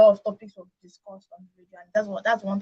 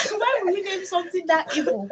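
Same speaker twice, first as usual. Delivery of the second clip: laughing disbelief; 8 semitones higher than usual. Real, not acted.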